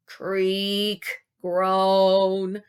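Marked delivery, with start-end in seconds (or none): none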